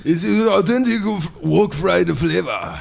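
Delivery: high, slow, shouted martial-arts cry; ninja voice. Acted. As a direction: bark it like a movie ninja. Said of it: "ninja voice".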